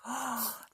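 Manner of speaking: quick startled gasp; mock gasp. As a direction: gasp as if the arm is here. Acting scared at story end.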